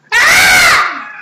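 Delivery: high loud scream of fright; sudden shriek of terror; frightened scream. Shown as a demonstration. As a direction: let out a big scared scream. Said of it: "¿Cuál es una voz de miedo?".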